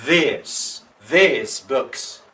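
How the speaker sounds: these with a stretched, stressed long E vowel. Shown as a demonstration. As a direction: lean on the E of these, long and clear. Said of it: "hacemos más énfasis en la E".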